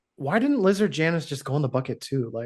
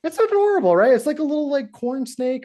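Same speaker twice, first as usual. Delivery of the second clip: высокий, восторженный писк на «adorable»; gushing adorable; 10 semitones higher, 4 dB louder than usual. Real, not acted. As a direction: squeal It's adorable way up high and loud. Gushing over the snake.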